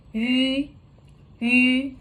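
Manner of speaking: "Yu" held long and level, lips rounded on an ee sound; demonstrated vowel. Shown as a demonstration. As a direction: sustain each "yu" steadily for learners. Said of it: "Listen to me".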